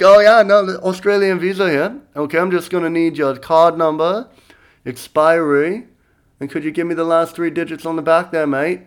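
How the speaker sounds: put-on Australian, chirpy fake customer service; Australian pretending to be Visa. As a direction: play an Aussie con man posing as card support. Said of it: "he could have pretended to be Visa".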